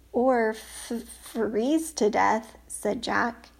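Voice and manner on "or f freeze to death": shaky whisper, stammering cold; shivering. Acted. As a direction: whisper it half frozen. Freezing, shivering.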